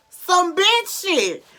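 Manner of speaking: high, loud, slow punchline; drawn-out exasperated emphasis; emphatic repeat. Real, not acted. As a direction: hit it hard and slow.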